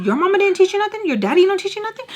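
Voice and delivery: very high, loud clapback; shrieky, fast sassy retort; mouthy. Retold or imitated. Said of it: "So I start getting mouthy".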